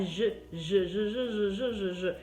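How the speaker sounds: "je" repeated as a buzzing French zh; Frenchified J. Shown as a demonstration. Teacher drilling the J sound, Frenchified "je". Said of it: "esse som afrancesado".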